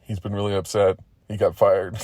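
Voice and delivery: ordinary delivery, nothing marked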